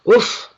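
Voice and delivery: sudden high loud oof of being overwhelmed; emotional oof. Real, not acted. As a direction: let out a high, overcome oof. Overcome by emotion mid-reading.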